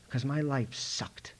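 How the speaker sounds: slow whisper; whispered confession. Real, not acted. Father admits his despair to the audience, slow whisper. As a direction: breathe it out, ashamed.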